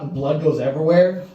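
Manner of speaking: ordinary talk, nothing marked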